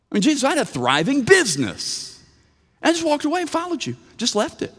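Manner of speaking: high, pleading Peter protesting his loss; indignant Peter. Retold or imitated. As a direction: play Peter insisting he gave up everything, with a laugh of disbelief. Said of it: "so Peter says".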